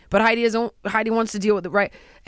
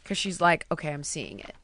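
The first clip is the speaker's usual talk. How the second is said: grudging quiet okay; 6 semitones lower, 9 dB quieter than usual. Retold or imitated. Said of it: "she's starting to go".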